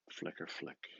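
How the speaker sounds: whisper. Acted. Fading whispered thought.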